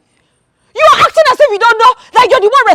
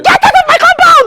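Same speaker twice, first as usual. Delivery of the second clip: angry shout; 8 semitones higher than usual. Acted. Wife drives visitor out.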